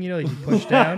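laughing through the words. Real, not acted. Describing the dry tap to friends, laughing. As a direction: giggle while explaining the tap.

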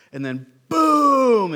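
loud, slow, drawn-out boom; booming slam. Acted. Describing the bow crashing down, a loud slow boom. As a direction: boom it out like the hull hitting water. Boat slamming down in chop.

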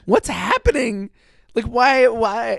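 high, exasperated questioning; hammed-up baffled viewer; mock bafflement. Mock bafflement at the actor's accent.

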